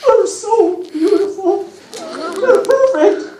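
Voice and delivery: a satyr overcome with happy tears; weeping with joy. Acted. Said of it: "Grover was weeping with joy".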